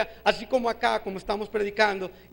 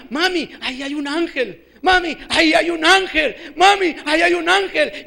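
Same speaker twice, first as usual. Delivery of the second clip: put-on a small boy's cry, repeated and growing louder; child calling out; 5 semitones higher, 7 dB louder than usual. Little boy shouting to his mother about an angel, building cry. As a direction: call to your mother, louder each time. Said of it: "me gritaba y me decía".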